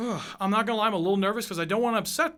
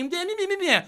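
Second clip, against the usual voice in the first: high, sing-song dismissive blah; mocking blah blah; 9 semitones higher than usual. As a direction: brush their excuses off with a high mocking tone. Mocking others' excuses.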